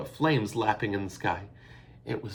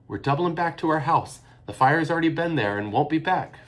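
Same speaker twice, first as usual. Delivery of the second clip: urgent; 7 semitones higher, 6 dB louder than usual. A narrator's reading. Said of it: "Fiona answered urgently".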